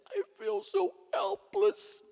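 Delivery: high, broken despair; grieving mother telling the priest she feels helpless; helpless plea. Retold or imitated. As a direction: speak as if breaking down. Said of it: "She said".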